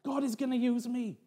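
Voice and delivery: high-pitched, thrilled declaration; excited. Retold or imitated. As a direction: marvel aloud at being chosen. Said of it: "I was so excited".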